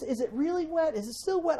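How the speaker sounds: high, innocent wondering tone; curious mock voice. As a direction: play the kid who just has to check. Mimics curious paint-toucher.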